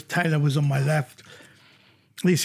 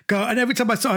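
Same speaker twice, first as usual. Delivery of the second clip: ordinary talk, nothing marked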